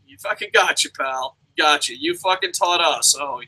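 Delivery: normal talking voice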